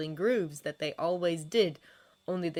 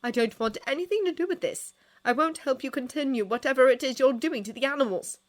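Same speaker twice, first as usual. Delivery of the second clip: high, heated refusal; worked-up protest; defiant refusal; 8 semitones higher, 6 dB louder than usual. A narrator's reading. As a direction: refuse hotly, voice raised high.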